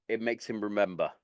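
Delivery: ordinary talk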